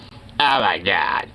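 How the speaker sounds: low mock "smug heckler" voice. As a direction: lightly imitate a smug woman in the audience. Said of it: "that was so goddamn smug".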